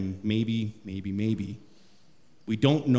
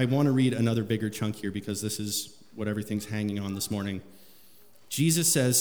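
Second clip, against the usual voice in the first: ordinary talk, nothing marked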